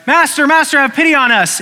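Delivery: high, loud desperate plea for mercy; pleading cry. Retold or imitated. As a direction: beg the Master for pity, voice straining up. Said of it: "and you're like".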